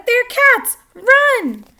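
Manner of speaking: high, loud panicked yell; panicked shout. A narrator's reading. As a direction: shout the warning, terrified. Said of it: "took off screaming".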